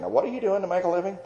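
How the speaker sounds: imitating parents asking, high and fast; parents' question. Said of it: "my parents are saying".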